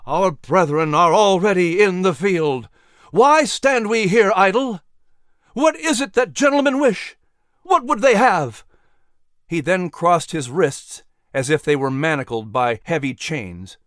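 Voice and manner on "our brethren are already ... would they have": much higher and louder, building; climbing oration. Acted. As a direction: rouse the men to their feet. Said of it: "his voice grew to such a pitch".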